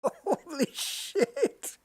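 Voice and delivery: slow, laughing disbelief. Real, not acted. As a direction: laugh it out in amazement. Laughing at the wheel's force.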